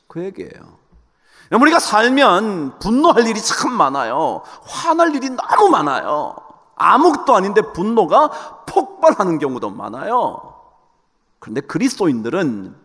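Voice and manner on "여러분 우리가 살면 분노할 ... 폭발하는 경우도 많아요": raised, emphatic preacher's voice; forceful preaching. Acted. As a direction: preach it high and urgent.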